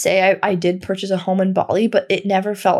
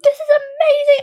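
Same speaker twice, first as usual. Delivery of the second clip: much higher, squealing with delight; excited squeal; 3 dB louder than usual. Retold or imitated. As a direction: gush it out like you can't contain yourself. Thrilled walking into dream home.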